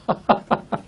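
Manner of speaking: quiet, breathy chuckle; amused chuckle. Real, not acted. Preacher laughing at a misreading he calls comedy, soft chuckle. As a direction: laugh softly to yourself.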